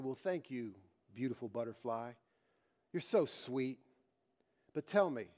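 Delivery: a grateful maiden speaking tenderly to the butterfly; sweet maiden. A narrator's reading.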